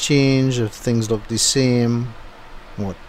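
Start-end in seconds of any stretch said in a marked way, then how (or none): none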